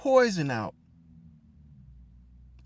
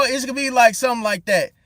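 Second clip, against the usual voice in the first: normal talking voice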